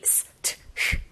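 "스, 트, 흐" as three separate voiceless sounds; whispery, separated "스, 트, 흐"; isolated consonant sounds. Shown as a demonstration. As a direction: sound out "스, 트, 흐" one by one. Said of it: "자음이 연속적으로 세 가지가".

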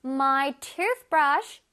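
I wash my hands with soap, each word drawn out for the class; very slow model reading. Shown as a demonstration. Teacher reads the example sentence slowly to the children. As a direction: read it slowly so children can follow. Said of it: "teacher models the example sentence".

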